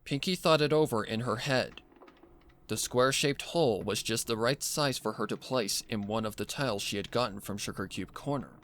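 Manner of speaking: ordinary delivery, nothing marked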